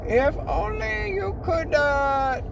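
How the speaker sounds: very high, slow wheedling demon; wistful high demon plea; cacodemon character voice. Acted. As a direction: wheedle in a squeaky little monster voice. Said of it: "let me get the voice".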